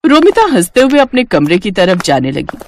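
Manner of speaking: usual speaking voice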